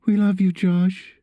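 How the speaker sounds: high voice of a distressed mother; struggling mom. A narrator's reading. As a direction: lift it high, a mother managing only a few words. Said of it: "is all his mom could muster".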